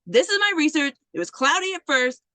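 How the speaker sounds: high-pitched, unrelenting argument; stubborn insistence. Retold or imitated. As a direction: imitate a student refusing to be dismissed. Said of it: "she's not gonna stop talking to you".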